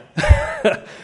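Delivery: a burst of airy, unvoiced laughter; breathy laugh. Real, not acted. Pastor laughing at parents telling a kid he could have asked.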